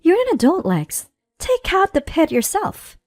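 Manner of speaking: high, exasperated comeback; sharp retort. Acted. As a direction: snap back, patience gone. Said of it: "I retorted".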